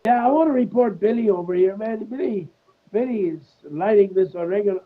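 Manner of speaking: high comic stoner tattletale; Cheech. Retold or imitated. Quoting Cheech reporting Billy in class, high mock complaint. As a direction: play a high-voiced stoner pupil telling on someone. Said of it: "Cheech goes".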